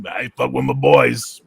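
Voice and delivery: slow, loud, cartoonish tough-guy line; imitating the bouncer's cartoonish boast. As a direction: drawl it out like a cartoon bruiser. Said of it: "he sounded like a cartoon".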